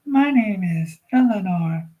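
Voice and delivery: put-on a small girl introducing herself sweetly; baby voice. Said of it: "let me try that again".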